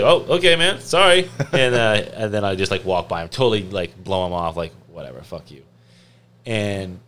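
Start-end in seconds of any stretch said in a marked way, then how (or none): 0.0-1.3 high, loud flustered apology; apologetic salesman. Retold or imitated. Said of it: "he's like". 4.9-5.6 soft dismissive aside; muttered brush-off. Retold or imitated.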